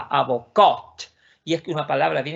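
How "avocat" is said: "avocat" slow, "cat" punched louder; last-syllable stress. Shown as a demonstration. Teacher marking the last syllable, final-stressed "avocat". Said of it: "La última sílaba".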